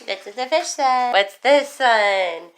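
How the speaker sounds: normal talking voice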